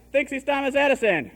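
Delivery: high, mock-taunting shout; retold group shout. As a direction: shout it out like tattling kids. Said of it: "We all shouted".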